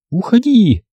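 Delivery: high cry to drive it away; shout. A narrator's reading. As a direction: shoo the dog off. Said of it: "крикнула Рен".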